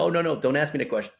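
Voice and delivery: high, fast brush-off of a reluctant caller; lightly voiced caller who only wants a yes or no; mock impatient caller. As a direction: wave off the question like an annoyed caller. Caller quoted refusing questions.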